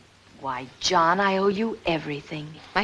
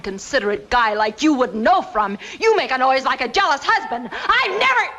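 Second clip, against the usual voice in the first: indignant outburst building higher; heated anger; 6 semitones higher, 4 dB louder than usual. Acted. Quarrel over a jealous husband's noise, angrily rising. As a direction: let the temper climb until it breaks off.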